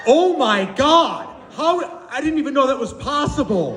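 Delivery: high, drawn-out mock astonishment; amazed. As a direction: gush as if you just saw a magic trick. Acting woman amazed by seal trainer.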